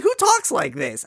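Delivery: ordinary talk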